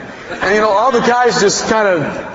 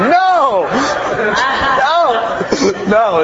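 protesting no; 7 semitones higher, much slower than usual. Retold or imitated. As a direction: pitch jumps up on each no in mock alarm. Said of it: "I'm going".

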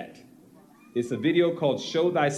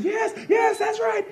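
high shouted crowd cheer; imitating a cheering congregation; crowd shouting; 4 dB louder than usual. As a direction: yell it like an approving crowd. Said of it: "the crowd started saying".

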